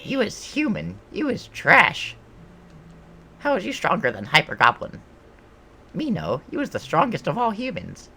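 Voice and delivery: high-pitched goblin sore loser; indignant goblin. Acted. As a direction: protest in a squeaky, high goblin voice.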